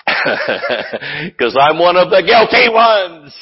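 loud, drawn-out laughing admission; laughing confession. Real, not acted. Preacher confessing his own guilt to the congregation, laughing loudly.

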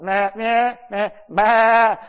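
high, loud stammered bleats; speechless bleating. Retold or imitated. Brother too shaken to answer his sister, mock bleating. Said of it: "I couldn't even talk".

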